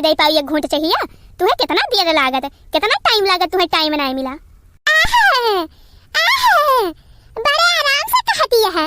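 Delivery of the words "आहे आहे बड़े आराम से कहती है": outraged exclamation. Acted. Quarrel, mocking outraged exclamation.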